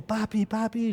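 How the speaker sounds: put-on high child voice calling daddy; imitating the daughter's cry. (imitando a la hija que llama a papá)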